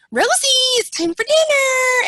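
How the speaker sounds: high, loud mocking echo; sarcastic mimicry of the condescending caregiver; mocking it back. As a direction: throw the patronizing tone right back. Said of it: "kinda, like, gave it back to her".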